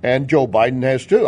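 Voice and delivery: ordinary delivery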